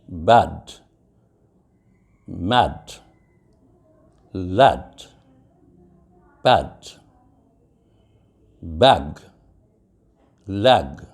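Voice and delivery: "bad, mat, lat" said as "bed, met, let", short E; low, isolated drill words from "bad" to "lat", short E in each; short E demo. Shown as a demonstration. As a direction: read "bad, mat, lat, pat, bag, leg, lat" as a spaced short-E list. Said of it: "it would become a shorter sound".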